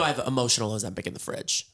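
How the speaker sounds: ordinary delivery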